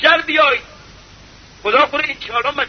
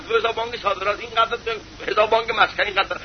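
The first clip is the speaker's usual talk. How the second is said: mock boasting rattle; 4 semitones lower, 5 dB quieter, faster than usual. Preacher mocks boasting of bank accounts.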